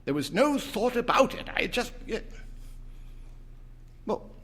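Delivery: insist, then falter; husband insisting then noticing wife; insistent old man. A narrator's reading.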